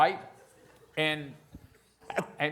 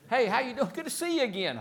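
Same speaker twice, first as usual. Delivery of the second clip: much higher, hammed-up glad-handing hello; fake friendly greeting; 11 semitones higher than usual. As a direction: greet a stranger like an old pal, overdone. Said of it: "you learn how to bullshit".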